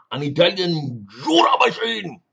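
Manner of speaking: ordinary talk, nothing marked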